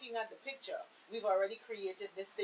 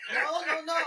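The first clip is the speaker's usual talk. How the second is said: laughing, louder and higher; 7 semitones higher, 8 dB louder than usual. Real, not acted. Host laughing to correct a caller's mix-up. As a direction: laugh the denial out.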